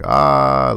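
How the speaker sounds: mock a nervous cry, held long. Rick as the zombies get near, acted nervous cry. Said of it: "Rick is starting to get nervous".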